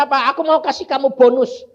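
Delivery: high and loud, excited; excited telling. Retold or imitated. Preacher excitedly retelling the Lord's bonus, high and loud. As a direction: announce the surprise with joy.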